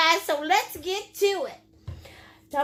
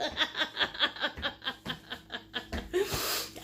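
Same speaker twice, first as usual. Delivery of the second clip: long, soft trailing chuckle; low breathy giggling that tails off; laugh; 4 semitones lower, 11 dB quieter than usual. Real, not acted. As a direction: laugh it out quietly after a whoop. Host laughing after own whoop.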